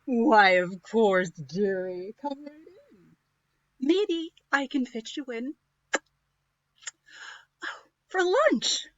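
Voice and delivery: slow, sly wicked witch. Acted. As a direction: lure the prince in sweetly. Hungry witch voice.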